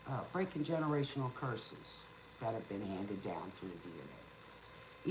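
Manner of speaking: usual speaking voice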